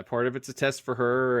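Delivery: usual speaking voice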